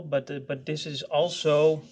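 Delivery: usual speaking voice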